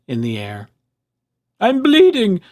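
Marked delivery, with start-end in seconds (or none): 1.6-2.4 high, loud alarmed yelp. A narrator's reading. Fox waking up after the extraction, yelping. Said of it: "the fox yelped".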